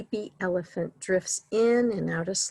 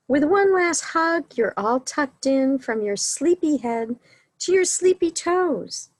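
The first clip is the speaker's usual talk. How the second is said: high, louder cooing finish; sweet high bedtime; 6 semitones higher, 5 dB louder than usual. Acted. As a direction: coo it warmly to a little one. Final hug, tender high read.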